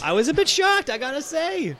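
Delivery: high, excited exclamation. Real, not acted. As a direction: pitch way up in mock shock. Host admits shock, voice jumps.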